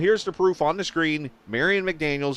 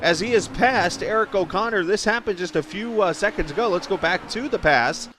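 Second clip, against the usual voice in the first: raised, slowed race-call voice; play-by-play call; 5 semitones higher than usual. Acted. Commentator announcing a new race leader, excited call. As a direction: call the lead change like a broadcaster.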